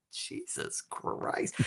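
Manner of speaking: slow, whispered retold oath; exasperated disbelief. Auditioner reacting inwardly to the casting director, slow whispered exasperation. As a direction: draw it out in an unvoiced, appalled whisper.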